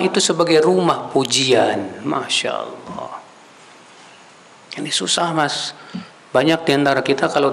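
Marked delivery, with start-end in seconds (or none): none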